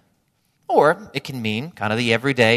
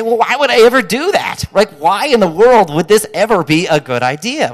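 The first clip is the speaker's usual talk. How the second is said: mock disbelief, louder and higher; incredulous objection; 6 semitones higher, 11 dB louder than usual. Preacher voicing a listener hearing Love your enemies, mock incredulous. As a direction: scoff at the very idea. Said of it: "you say".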